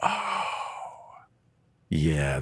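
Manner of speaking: ordinary delivery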